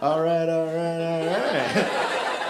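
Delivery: lazy, laid-back stoner drawl, lightly done; a hint of a mellow stoner movie star; easygoing stoned driver, lightly imitated; Matthew McConaughey. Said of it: "rear-ended by Matthew McConaughey".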